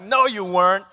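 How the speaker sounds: mock prosecutor disbelief; grilling child. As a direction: imitate a small boy calling out dad's excuse. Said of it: "state prosecutor is, like, grilling me".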